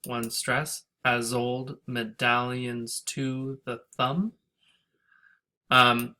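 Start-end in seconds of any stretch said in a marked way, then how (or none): 1.1-4.3 put-on "as old medallions to the thumb" slow, beat by beat; slow scansion. Shown as a demonstration. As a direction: tap out the iambs slowly. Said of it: "We have iambic again".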